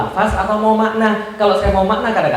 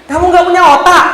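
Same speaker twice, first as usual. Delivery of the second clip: loud, high, insulting question; raised-voice, outraged challenge; blunt rebuke; 9 semitones higher, 8 dB louder than usual. Shown as a demonstration. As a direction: throw it at them as a scolding. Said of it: "Bacanya begini".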